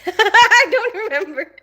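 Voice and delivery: high, loud burst of laughter through the words; helpless high-pitched laughing. Real, not acted. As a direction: crack up while admitting it. Forgets the lyrics and bursts out laughing.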